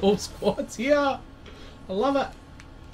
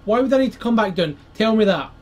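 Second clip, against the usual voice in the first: annoyed rant; 5 semitones higher, 8 dB louder than usual. Real, not acted.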